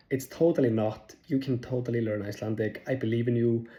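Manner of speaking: ordinary delivery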